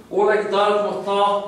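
tight, pushed-up voice from the neck; strained greeting; throat-pushed voice. Shown as a demonstration. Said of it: "O más para adentro la voz".

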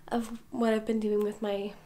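ordinary talk

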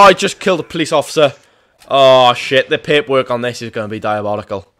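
ordinary talk, nothing marked

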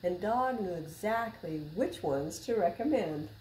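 put-on reading to children. A narrator's reading. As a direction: read it like story time for little kids. Reading a picture book aloud.

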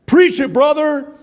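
high, loud churchgoer shout; yelled amen. Retold or imitated. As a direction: shout it out from the pews. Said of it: "there's not a lot of people yelling".

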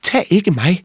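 high, loud, squeaky pleading; frightened little goat. A narrator's reading. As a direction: plead for mercy as a tiny scared goat. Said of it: "blev meget bange".

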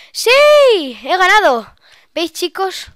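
high, very loud victory yell; triumphant shout. Real, not acted. Player celebrating that she has won Skywars, triumphant shout. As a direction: shout your win out.

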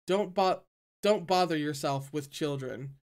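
ordinary delivery, nothing marked

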